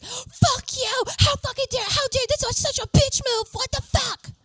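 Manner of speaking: very high furious shriek impression; mock screaming Angela. Angela blowing up at Haley, very high mock shriek. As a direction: scream it high like a hammed-up rage. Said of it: "Angela was like".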